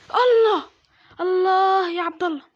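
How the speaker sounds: high, drawn-out cry of reaction; loud exclamation. Real, not acted. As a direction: call it out loud and stretched. Reacting to a moment in game.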